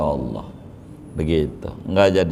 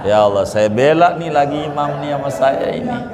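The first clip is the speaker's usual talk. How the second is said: higher, louder, fast and pleading; a hammed-up cry of pity, rushed; playfully wailing about the poor imam; mock lament; 8 semitones higher, 4 dB louder, faster than usual.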